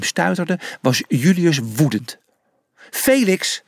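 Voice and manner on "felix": angry shout. A narrator's reading. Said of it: "riep hij boos".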